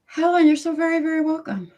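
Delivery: high, bright sing-song welcome; gushing welcome. Real, not acted. Host gushing a welcome to caller Helen, very high and delighted. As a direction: lift it way up, beaming at the caller.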